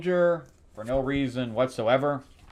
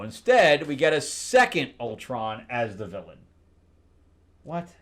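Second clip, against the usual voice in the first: ordinary talk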